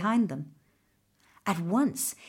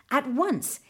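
ordinary delivery, nothing marked

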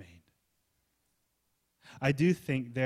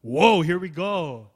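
louder, slow drawn-out "Whoa"; thrilled sailors; 4 semitones higher, 8 dB louder, slower than usual. Retold or imitated. Apostles setting sail with a fair wind, loud and drawn out. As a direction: ride the wind with delight. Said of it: "it's like".

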